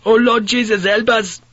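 high, loud desperate plea; terrified scream. A narrator's reading. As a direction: cry out for rescue in terror. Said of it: "he screamed in Dutch".

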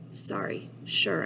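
whispered bitter echo; sarcastic. A narrator's reading. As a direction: throw the word back silently. Bitter inner echo of apology.

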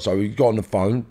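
normal talking voice

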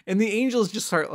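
normal talking voice

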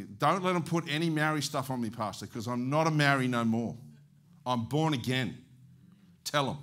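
loud, forceful insistence; emphatic insistence. Retold or imitated. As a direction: lay down a firm order, loudly. Dying man's firm instruction, retold.

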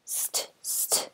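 "St, st" whispered as a hissed blend; whispered phonics "st"; sounding out st. Shown as a demonstration. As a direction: breathe out the "st" sound with no voice. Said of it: "to make it say star".

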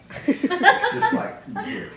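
a high little laugh; light laugh. Real, not acted. Host laughs about friend.

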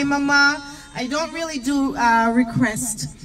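ordinary talk

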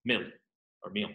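mil as one closed syllable, sounding like meal. Shown as a demonstration. Teacher giving students the right closed mil. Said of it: "So not mila, but".

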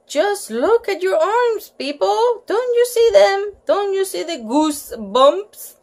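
insistent high. Acted. Lucille insisting to class.

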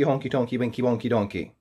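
ordinary delivery

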